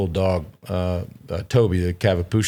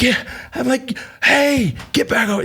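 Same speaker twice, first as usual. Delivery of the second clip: mock yell pitched way up; retold yelling. Storyteller re-enacting his yell at Courtney and Woody, high mock holler. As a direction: imitate a high, alarmed holler without full volume. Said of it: "I start yelling at Courtney and Woody".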